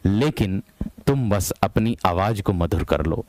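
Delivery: usual speaking voice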